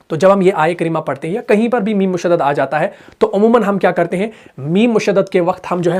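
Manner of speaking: normal talking voice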